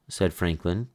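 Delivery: usual speaking voice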